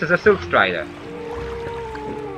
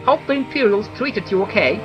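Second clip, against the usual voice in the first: higher whiny villager voice; Fargoth; 7 semitones higher, 4 dB louder than usual. Acted. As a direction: voice a fussy whiny elf. NPC Fargoth dialogue voiced.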